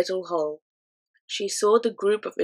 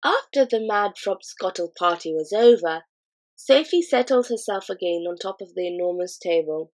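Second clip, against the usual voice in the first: plain reading voice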